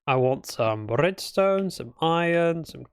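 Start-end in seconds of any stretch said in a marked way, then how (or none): none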